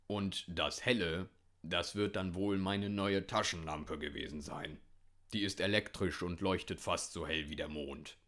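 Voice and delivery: lightly voiced grumbly old hunter; Hüttemann. A narrator's reading. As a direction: explain it as the gruff old hunter. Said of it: "brummte der alte Hüttemann". (brummiger alter Jäger, leicht verstellt)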